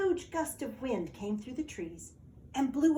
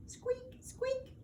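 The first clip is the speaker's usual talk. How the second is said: tiny, very high, soft squeak; lantern squeak; 7 dB quieter than usual. A narrator's reading. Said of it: "went the lanterns".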